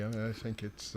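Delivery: normal talking voice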